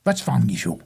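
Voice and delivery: grandmother's German accent, lightly imitated. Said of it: "she had a German accent".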